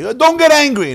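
high, loud sing-song scold; mock scold. A preacher mocking those who say don't get angry. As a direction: shush someone's anger in a prissy voice.

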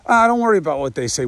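normal talking voice

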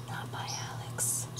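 very soft, voiceless whisper; hushed reminder under the breath; whispered disclaimer. Real, not acted. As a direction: whisper it as a secret aside. Same disclaimer repeated as whispered aside.

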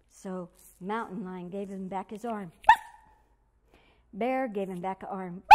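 ordinary delivery

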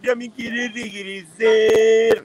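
high, slow whining plea of a homesick princess; whiny sister. Retold or imitated. Brother mocking his homesick sister's whine. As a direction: drawl it out like a spoiled girl begging to go home. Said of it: "pero dijo".

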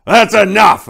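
high, loud angry bellow; thunderous command. Acted. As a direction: shut the whole crowd up at once. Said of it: "erupted Santa with a voice like thunder".

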